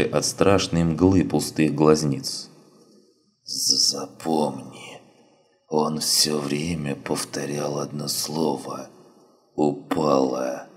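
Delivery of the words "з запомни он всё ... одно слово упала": weak, slow, faint dying voice; dying wounded man. Acted. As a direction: barely get the words out, fading.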